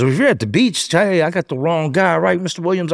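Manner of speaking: high-pitched mock-agreeable questioning; skeptical detective. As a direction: imitate a detective pretending to buy an alibi. Detective doubts alibi on phone.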